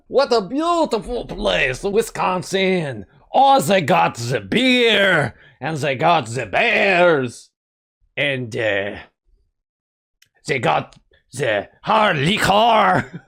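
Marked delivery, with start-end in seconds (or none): 0.1-7.5 slow, hammed-up French accent; fake French accent. Player voices the skier admiring Wisconsin, fake French accent. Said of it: "put on a fake French accent". 10.5-13.2 put-on slow French accent, breaking into laughter; fake French accent. Player voices the skier praising Wisconsin, fake French accent. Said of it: "put on a fake French accent".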